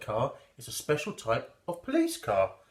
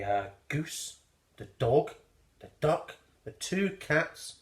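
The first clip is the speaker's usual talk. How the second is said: ordinary delivery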